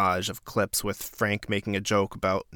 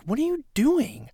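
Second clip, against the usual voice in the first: outraged disbelief. Real, not acted. Outraged at the behavior.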